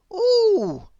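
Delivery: sudden high, loud oh; a bright lifted oh as an idea comes; high excited gasp of oh. Acted. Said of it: "he said".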